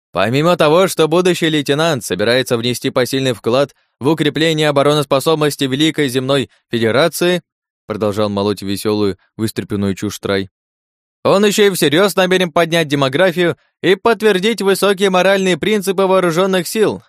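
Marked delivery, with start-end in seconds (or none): 0.1-7.4 loud, high showman announcing; booming circus ringmaster. Acted. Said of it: "как конферансье микрофоном". 11.2-17.0 loud, high showman announcing; booming circus ringmaster. Acted. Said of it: "как конферансье микрофоном".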